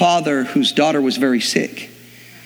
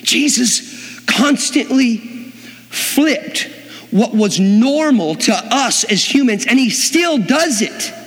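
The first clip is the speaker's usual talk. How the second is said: impassioned preaching; 10 semitones higher than usual. Real, not acted. Preaching climax.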